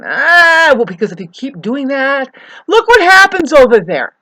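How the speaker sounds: high, loud frustrated yell; scream of frustration. Real, not acted. Railing at the aging neighbor, screaming high and loud. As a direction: scream it out at him.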